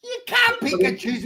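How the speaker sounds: high, indignant protest, played for laughs; mock-outraged cry at a friend; mock outrage. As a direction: pitch it way up in fake outrage. Mock outrage at Mark's choice.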